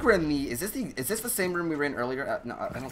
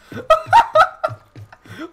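loud high laugh; 12 dB louder than usual. Real, not acted. Laughing at teammates' antics.

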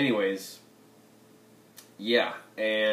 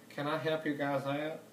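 high, soft Southern lady offer; a sweet Southern woman asking to help; polite Southern country woman; 5 semitones higher, 5 dB quieter than usual. Pretend. Vlogger imitating fans, polite Southern woman voice. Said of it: "That was polite Southern country woman".

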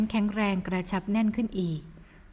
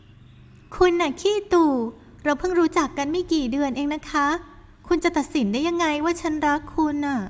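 teasing retort; 6 semitones higher than usual. Acted. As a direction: tease him back. Heroine retorts.

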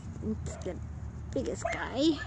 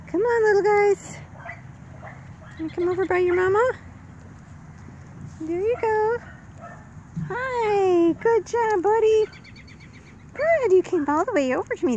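puppy talk; 8 semitones higher than usual. Real, not acted. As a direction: coo at the puppies up high. Coaxing puppies, high-pitched.

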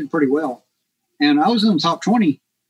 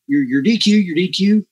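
a touch of annoyed scolding, higher; mildly mad official; 5 semitones higher than usual. Retold or imitated. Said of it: "he's kinda mad".

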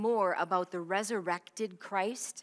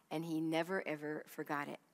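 soft emphasis; 3 semitones lower, 7 dB quieter than usual. Real, not acted. Preacher's emphatic repetition.

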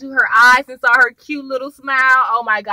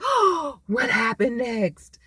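mock cliffhanger gasp; 6 dB quieter, much slower than usual. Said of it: "or it can end like".

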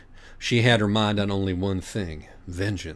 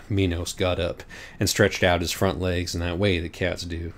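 usual speaking voice

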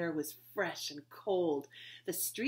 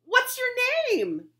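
high, loud and slow call; 12 dB louder, slower than usual. Acted. Betsy calling to the shy new girl, loud and drawn out. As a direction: call it slowly across the snow.

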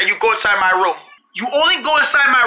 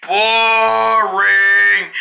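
long, drawn-out low jeer; bored heckle; 4 semitones lower than usual. Acted. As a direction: drag it out to mock the act. Audience member heckles the act.

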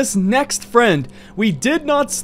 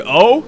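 "Oh?" as a sharply rising, louder question; 2 semitones higher, 5 dB louder than usual. Acted. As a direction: make "Oh?" curl upward like a question mark. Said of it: "Question mark".

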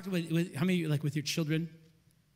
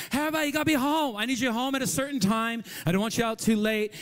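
raised, rushed dad voice at first; father calling out; 4 semitones higher, 4 dB louder, faster than usual. Acted. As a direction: call over the household chaos. Father calling over daughters' chaos.

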